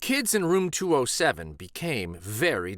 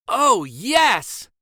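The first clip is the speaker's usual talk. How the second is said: high, loud delighted cry; kids crying out; 11 semitones higher, 7 dB louder than usual. A narrator's reading. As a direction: cheer it out. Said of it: "cried the kids".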